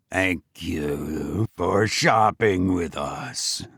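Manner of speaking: slow, low, eerie politeness; creepy bagger. Acted. Bloody bagger thanking the shaken shopper, slow low eerie.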